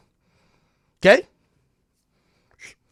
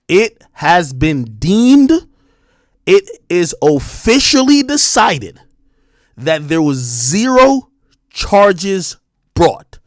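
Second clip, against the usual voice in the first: each word laid out slowly and louder; slow and deliberate; 5 dB louder, much slower than usual. Real, not acted. Telling chat the ruling, slow and deliberate.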